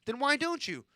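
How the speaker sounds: curious question. Retold or imitated. Kid asking why he isn't suspended, genuinely curious. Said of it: "Because I was curious as to why".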